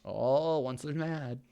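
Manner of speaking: ordinary delivery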